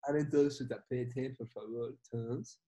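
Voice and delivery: meditation guide. Retold or imitated. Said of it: "Bambo era su apodo".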